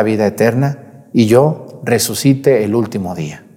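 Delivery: loud, solemn church reading; Gospel proclamation. Acted. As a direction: proclaim the scripture to the congregation.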